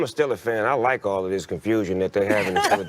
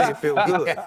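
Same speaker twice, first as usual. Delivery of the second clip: laughing; 7 semitones higher than usual. Real, not acted. Laughing joy over his team.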